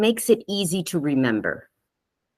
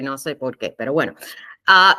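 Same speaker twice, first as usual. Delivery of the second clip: ordinary delivery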